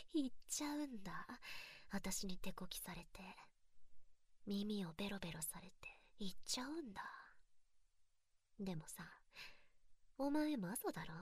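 breathy teasing whisper close to the ear. Acted. Mocking her partner in a close, teasing whisper. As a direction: whisper it like a secret taunt.

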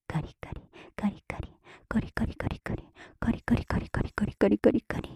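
whispered "カリカリ" building into an unbroken run; quickened repetition. Acted. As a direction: let the repeated syllables pile up. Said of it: "ペースが速くなる".

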